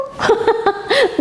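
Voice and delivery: a short, high laugh; amused high giggle. Real, not acted. Mother amused by child's logic.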